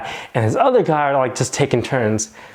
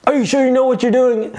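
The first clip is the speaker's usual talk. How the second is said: doubtful questioning; 11 semitones higher, faster than usual. Retold or imitated. Skeptical bystander questioning her.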